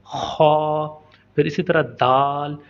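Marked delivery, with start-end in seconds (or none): 0.1-1.0 खौ rasped heavy from the back of the throat; thick throaty kh. Shown as a demonstration. Teacher demonstrating a thick throaty खौ to students. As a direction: clear your throat on खौ, thick and rough. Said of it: "यही तलफ़ुज़ है".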